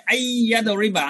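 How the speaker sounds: আই ইয়া দরিবা with a long doubled y; tashdid pronunciation. Shown as a demonstration. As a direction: lean on the y joining আই and ইয়া in আই ইয়া দরিবা. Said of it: "আমি তাসদিদের উচ্চারণ করতেছি". (আই ইয়া দরিবা-তে তাসদিদ টেনে উচ্চারণ)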